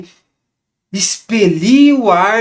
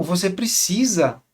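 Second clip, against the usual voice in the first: ordinary talk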